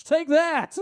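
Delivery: high, loud gloating yell; triumphant shout. Acted. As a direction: shout it like a victory cry.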